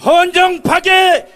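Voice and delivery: shouted slogan call. Real, not acted. Rally leader calls slogan.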